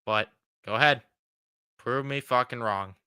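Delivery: usual speaking voice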